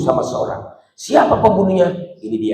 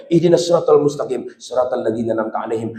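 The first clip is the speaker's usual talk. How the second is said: ordinary talk, nothing marked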